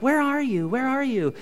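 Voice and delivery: searching wife. Acted. Wife phoning husband from across Walmart, mildly insistent. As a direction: lift the pitch, imitating her repeated question.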